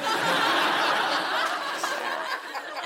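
airy high giggle, nearly silent; high breathy laugh. Real, not acted. To the audience, amused at her own cheek, airy laugh. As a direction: let a breathless laugh escape.

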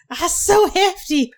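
high, slow whiny voice; mock hefty voice. Mock self-pity in put-on voice.

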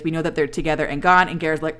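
ordinary talk, nothing marked

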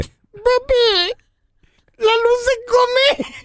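put-on very high, childlike little voice; voz de su hijo. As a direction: imitate your small son asking Dad slowly. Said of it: "mi hijo y me dice". (voz aguda de niño)

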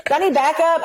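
high mock-worried question; flustered rookie. Rookie officer unsure what to do, flustered.

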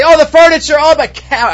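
high, loud mock lament; lamenting homeowner. Mourner bewails the burned furniture, mock lament. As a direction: wail over lost furniture, hammed-up. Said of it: "Some say".

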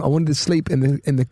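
normal talking voice

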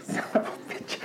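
breathless whispered oath; a whispered son of a bitch as he backs up; whispered curse. Retold or imitated. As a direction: hiss it under your breath in alarm. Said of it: "I said".

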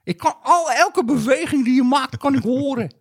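high, slow neighbour voice; Jacques de Vries impression. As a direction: play the nosy neighbour, high. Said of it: "Maar dat is Jack de Vries".

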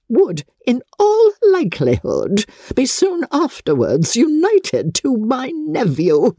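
climbing high in outrage on Elizabeth's name; scandalised grande dame; indignant Lady Catherine. Acted. Lady Catherine recounts the report.

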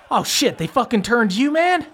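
high-pitched, incredulous vampire henchman; startled Langston. Acted. Langston sees his friend turned vampire, high startled voice. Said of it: "he turns around, he looks at you, and he goes".

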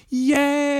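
high squealed yay then giggle; mock kid yay. Recalling dad buying the Nintendo, squealing yay. Retold kid joy at gift.

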